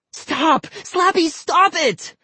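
high, pleading cry; a scared boy begging Slappy. Acted. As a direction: beg the dummy to stop. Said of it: "Vinny pleaded".

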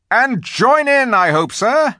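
high, loud, hearty soldier's reply; loud, high-pitched centaur, stretching the words; eager centaur. A narrator's reading. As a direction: pipe up keenly to the commander. Centaur eagerly answers the leader.

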